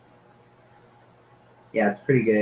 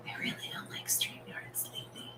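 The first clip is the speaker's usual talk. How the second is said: hushed whisper of a complaint; whispered gripe; 18 dB quieter than usual. Real, not acted. Host grumbling to chat about StreamYard, whispered.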